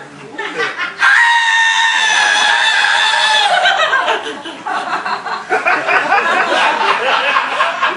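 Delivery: long, high, loud scream of terror; terrified scream. Acted. Swimmer spots an alligator behind her, long terrified scream. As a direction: shriek long and high in alarm.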